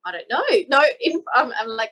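ordinary talk, nothing marked

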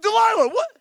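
high, loud mock outrage; indignant shout. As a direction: call her out in disbelief. Samson confronts Delilah.

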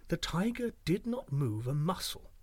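usual speaking voice

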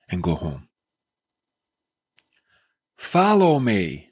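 solemn reading with dropping line ends; scripture reading. Acted. Reads Jesus' words aloud.

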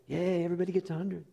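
quick, light sarcastic yay; mock cheer. Preacher mocking rating in church, sarcastic cheer. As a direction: cheer it like a fake party.

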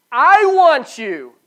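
loud, slow, high booming declaration; voice of God. Acted. Pastor voicing God to the congregation, booming slow declaration. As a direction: proclaim it slowly as God claiming his people. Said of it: "He says".

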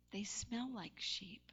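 slow hushed aside; whisper. Acted. Preacher whispering a joke to the congregation. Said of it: "whispered joke".

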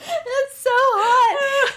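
high slow whining about heat; whiny complaining. Retold or imitated. Hot in the car floor.

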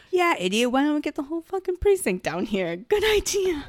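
high, rising mock-sarcastic rant; sarcastic scorn. Blonde in charge mocking his idea, rising sarcastic scorn. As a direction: climb in pitch to a squeaky, scornful finish. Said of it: "the blonde girl that's clearly in charge".

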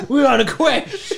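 high, loud laughing declaration; laughing mock epic. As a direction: laugh through a grand claim. Mock epic quest joke.

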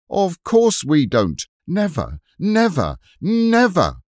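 put-on a boy shouting defiance, climbing to a yell; defiant shout. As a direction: hurl the refusal, rising each time. Robert defies besiegers.

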